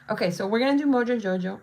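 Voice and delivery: ordinary delivery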